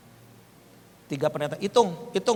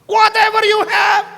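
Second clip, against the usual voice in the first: high, far louder cry; acted outburst; 11 dB louder than usual. Preacher relives the demand to give, shouting. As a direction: shout it out, pitched way up.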